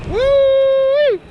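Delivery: high, loud joyful whoop; excited whoop. Real, not acted. Rider whooping down a hill, loud and high. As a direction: let out a wild cheer.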